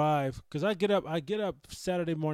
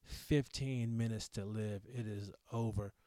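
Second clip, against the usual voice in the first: grim doctor; 3 semitones lower, 6 dB quieter than usual. Retold or imitated. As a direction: deliver the death sentence flatly. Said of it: "doctor just drops the fucking hammer".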